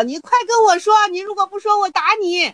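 pushy student pitched high, insisting; demanding disciple. Acted. Disciple demanding his master speak, high and pushy. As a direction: press him like an impatient disciple.

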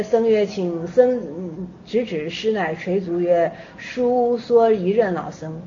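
put-on low, slow recitation of the old text; reading a Chan koan. Teacher reads the koan to students in low, slow recitation. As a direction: read the koan measured and low, like scripture.

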